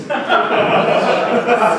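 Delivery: airy giggle; breathy laugh at own joke. Real, not acted. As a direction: laugh under your breath.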